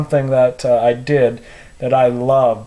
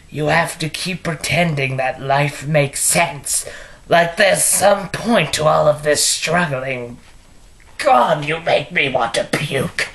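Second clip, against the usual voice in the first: taunting villain voice, theatrical and scornful; stagey supervillain sneering at his foe; Mark Hamill Joker. Acted. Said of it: "my Mark Hamill impression".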